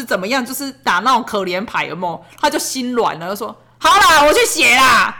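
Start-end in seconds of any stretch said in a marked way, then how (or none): 3.8-5.1 put-on reluctant child. Son reluctantly gives in.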